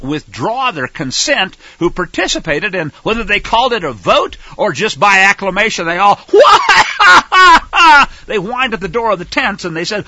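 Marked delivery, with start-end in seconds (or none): 6.2-8.2 high, loud mocking wail; mocking wail-laugh. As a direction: mimic the whining crowd with a wail. Mocking the whining crowd.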